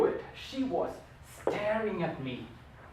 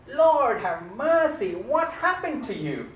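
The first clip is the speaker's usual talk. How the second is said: loud, slow Southern exclamation, higher; southern accent; 5 semitones higher, 10 dB louder, slower than usual. Retold or imitated. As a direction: cry it out like a Southern lady in Southern drawl. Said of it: "in her southern accent".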